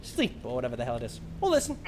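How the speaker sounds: quick, casual Australian rambling; lightly voiced Australian storyteller; Aussie comedian ramble. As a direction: chatter on like a bloke at the pub. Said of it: "he's like".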